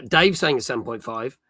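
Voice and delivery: normal talking voice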